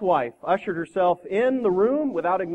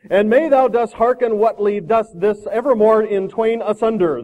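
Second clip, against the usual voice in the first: loud, slow, swelling oration; pompous supplicant; 5 semitones higher, 9 dB louder, slower than usual. Acted. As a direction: boom out the archaic prayer grandly. Pompous prayer reaches its climax.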